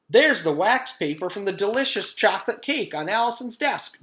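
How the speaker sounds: high, loud tattling announcement; announcing. Acted. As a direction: announce it proudly to the class. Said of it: "Joy announced".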